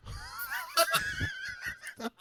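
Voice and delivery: high, gleeful villain laugh; villain cackle. Acted. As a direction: cackle like a smug comic-book baddie. Villain gloats after suit reveal.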